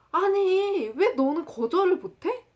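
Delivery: high, loud exasperated friend; 답답해서 다그침. Retold or imitated. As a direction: challenge them in mock exasperation. 답답한 친구의 다그침 흉내. (답답한 친구가 높고 크게 따지듯)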